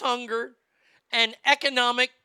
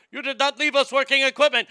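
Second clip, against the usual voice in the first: loud, higher-pitched, rapid mock outrage; angry complaint; 5 semitones higher, 7 dB louder, faster than usual. Taliban griping about broken military equipment, mock angry. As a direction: fire off an indignant complaint, fast. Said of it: "They're angry with us".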